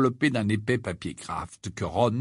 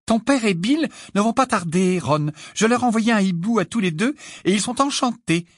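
high, louder, delighted mother voice; beaming mother; 9 semitones higher, 3 dB louder than usual. A narrator's reading. Mother telling her son who is coming, beaming. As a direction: share good news in a high, happy lilt. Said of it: "le visage rayonnant".

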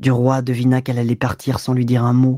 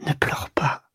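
softer, breathy whisper of comfort; 6 dB quieter than usual. Acted. Duroy comforts his crying mistress Clo in a soft whisper. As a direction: hush her gently, no voice at all. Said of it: "Il murmura".

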